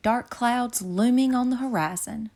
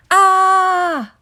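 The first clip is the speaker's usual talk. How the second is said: high very loud shriek; panicked scream; 11 semitones higher, 10 dB louder than usual. A narrator's reading. CJ screams to Cocky.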